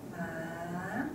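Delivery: "ma" softly gliding up from low; rising tone. Shown as a demonstration. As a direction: let "ma" climb, second tone. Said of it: "it's a rising tone".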